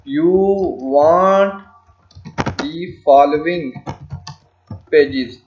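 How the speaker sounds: word by word, each one held; slow dictation. Shown as a demonstration. Tutor writes the client's page list, slow dictation. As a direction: spell out the sentence at typing speed. Dictating the next line while typing.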